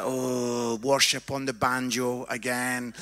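low, dreary monotone; unimpressed groan. Acted. Preacher mimicking a bored congregation at another church, unimpressed. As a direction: drone it low, with no enthusiasm at all.

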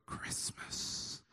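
hushed, drawn whisper. Retold or imitated. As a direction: breathe it out as a secret whisper. Women's reverent hushed cue, whispered.

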